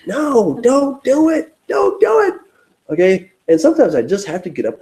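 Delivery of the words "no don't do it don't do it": very high, strained mock plea; self-restraint. Begging himself not to lash out, comically desperate. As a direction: plead with yourself in a squeaky put-on voice. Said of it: "but I'm like".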